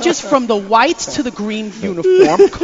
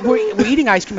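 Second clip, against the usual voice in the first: usual speaking voice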